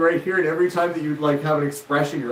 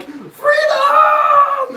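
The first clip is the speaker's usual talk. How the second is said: very high shouted Scottish freedom yell; Scottish battle cry; 8 dB louder than usual. Pretend. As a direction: scream it like a Highland rebel. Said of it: "I'm Scottish and I'm proud".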